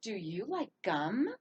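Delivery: "gum" swept upward as a question; teacher's clear asking tune, "gum" rising; question rise at end. Shown as a demonstration. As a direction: let the voice climb on "gum". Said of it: "it goes up at the end".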